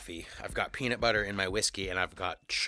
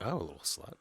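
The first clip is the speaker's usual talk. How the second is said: ordinary talk, nothing marked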